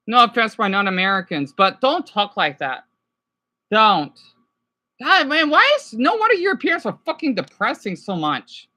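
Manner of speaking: normal talking voice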